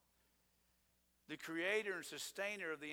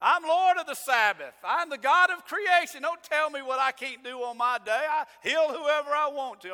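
higher and much louder, indignant mock outburst; sarcastic retort; 9 semitones higher, 13 dB louder than usual. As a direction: snap back like an offended boss, loud and high. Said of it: "said something sarcastic like".

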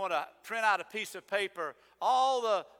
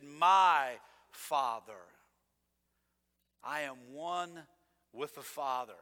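"My Father" said very slowly, "My" drawn out and falling; slow solemn quote; 3 semitones lower, 4 dB louder, slower than usual. Shown as a demonstration. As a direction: let "My Father" hang slowly and gravely, falling on "My". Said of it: "And Jesus said".

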